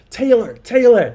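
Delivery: put-on high, slow, loud American-accent yell of "Taylor"; a playful American accent stretching out "Taylor"; mock American shout. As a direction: call "Taylor, Taylor" like an American player shouting on the pitch. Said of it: "just shouting away".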